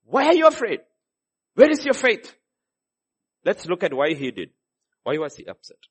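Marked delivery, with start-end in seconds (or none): 0.0-2.4 loud, higher, exasperated scolding; Jesus scolding his frightened disciples, loud and irritated; irritated rebuke. Acted. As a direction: rebuke the scared disciples sharply. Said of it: "Jesus got irritated".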